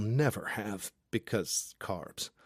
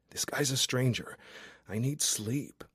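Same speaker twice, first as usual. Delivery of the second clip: ordinary delivery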